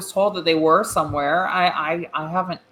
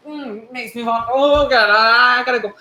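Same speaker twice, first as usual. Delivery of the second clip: higher and louder, rushed nauseous excuse; queasy panicked voice; 5 semitones higher, 4 dB louder than usual. Acted. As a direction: flee the table feeling sick. Character nauseous, excusing herself.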